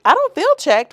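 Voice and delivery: loud, high sassy comeback; imitating housewife. Quoting the Real Housewives clapback, loud and high. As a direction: fire back like a reality star. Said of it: "She be like".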